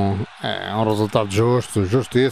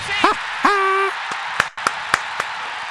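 gleeful laugh. Real, not acted. After scoring.

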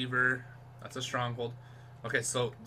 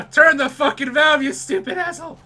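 high, loud frantic scolding; exasperated yelling; 11 semitones higher, 10 dB louder than usual. Real, not acted. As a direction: yell at the useless game character. Berating game character under fire.

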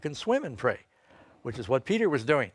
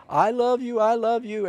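high, loud bellowed love call; bellowing; 9 semitones higher, 5 dB louder than usual. Retold or imitated. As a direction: bellow it up to the window. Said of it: "bellowing out in the street".

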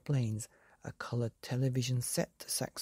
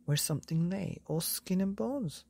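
plain reading voice

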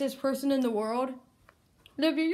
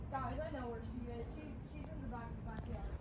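whispering; 18 dB quieter than usual. Real, not acted. Seeker whispering near a hiding spot.

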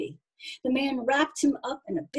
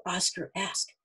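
ordinary delivery, nothing marked

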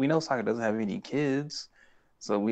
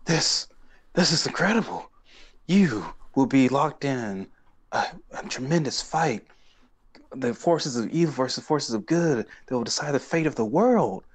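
dramatic prophecy; 4 semitones higher than usual. Retold or imitated. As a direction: intone a momentous vision with hammed-up gravity. Acting fortune-teller's grand prophecy.

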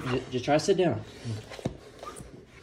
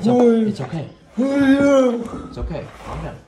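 loud slow pleading; 12 semitones higher, 7 dB louder, slower than usual. Real, not acted. Calming a man after seizure.

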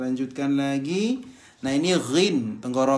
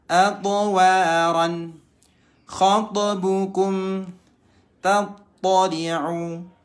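slow chanted tajwid reading; Quran recitation; 5 semitones higher, much slower than usual. Shown as a demonstration. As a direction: recite clearly, every letter crisp. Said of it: "Hati-hati, di sini ada qalqalah". (bacaan Al-Quran pelan dan jelas)

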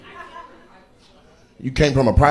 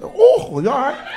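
mock audience member; 13 semitones higher than usual. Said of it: "Who said".

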